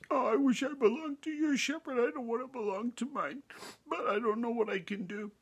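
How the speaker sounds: high, whispery little lamb voice; whimpering lamb. A narrator's reading. Lamb tells the visitors why he cries, high and tearful.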